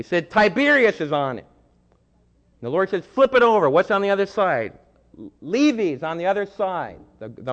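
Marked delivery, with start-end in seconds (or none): 3.0-4.8 higher, louder command; commanding Jesus. Retold or imitated. Jesus to the crowd, commanding them to flip the coin. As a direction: order them to turn the coin.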